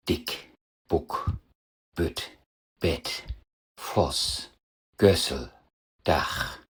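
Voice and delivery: Dick, Buck, Bütt, Bett, Voss, Gössel, Dach with short, quick vowels; isolated demo words, each vowel kept short. Shown as a demonstration. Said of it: "Kurze Vokale".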